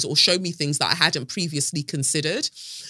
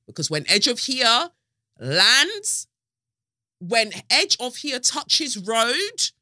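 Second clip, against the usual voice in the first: hyped promo; 6 semitones higher, 3 dB louder, slower than usual. Real, not acted. Hyping her book launch.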